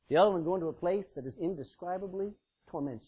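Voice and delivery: ordinary talk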